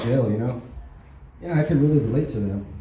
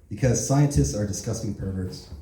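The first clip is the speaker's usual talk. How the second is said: ordinary delivery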